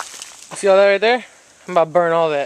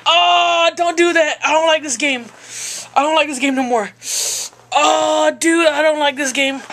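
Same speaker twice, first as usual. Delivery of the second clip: high-pitched whine of pain; pained whining; 8 semitones higher than usual. Real, not acted. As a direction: complain through the sting. Pain after ripping tape off.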